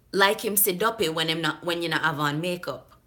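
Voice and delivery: attempted Jamaican patois; Jamaican accent. Vlogger reading a viewer's email as written, Jamaican accent. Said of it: "trying to deliver it in the way that she wrote it".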